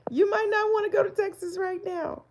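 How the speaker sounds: high, slightly calmer singsong warning; high playful repeat; high teasing repeat. Acted. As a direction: tease it again, still up high.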